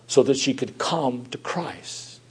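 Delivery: hushed whisper, barely voiced. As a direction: breathe it out softly to the congregation. Repeated line, whispered echo.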